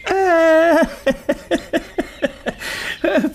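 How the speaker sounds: долгий высокий смешок гостя; добродушно рассмеяться высоким голосом; смех гостя. Acted. Гость смеётся, входя. (весёлый смешок старого профессора)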